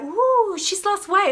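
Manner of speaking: high, cooing admirer; admiring gossip. Retold or imitated. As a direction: coo it like an impressed friend. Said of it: "It wasn't like".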